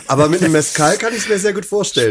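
high laughter then laughing words. Real, not acted. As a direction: giggle through the line. Laughs after tasting hot sauce.

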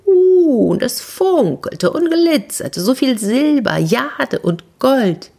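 high, slow, wonder-struck; amazed. A narrator's reading. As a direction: marvel at the glittering treasure. Girl marvels at treasure.

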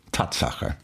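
breathy whispered aside. Real, not acted. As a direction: whisper it as a fact. Affirming a surprising fact.

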